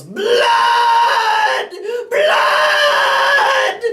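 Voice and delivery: screamed, crying anime voice; dub Gaara screaming. Retold or imitated. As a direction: wail it like a crazed villain. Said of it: "the dub yells".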